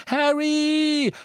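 very high, loud screech; shriek. A narrator's reading. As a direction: shriek a friend's name in alarm. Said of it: "kreischte Hermine".

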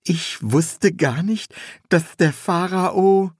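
high, slow, wondering apprentice; high-pitched apprentice voice; young apprentice, amazed. A narrator's reading. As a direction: draw it out high in naive wonder.

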